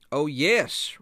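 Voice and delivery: dismissive reply. Acted. Traveler's reply in acted story.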